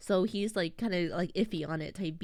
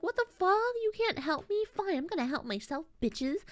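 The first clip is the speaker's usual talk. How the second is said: mock outrage; 10 semitones higher than usual. Maria refused help, higher indignant outburst. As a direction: snap it out in a high huff. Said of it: "And then Maria's like".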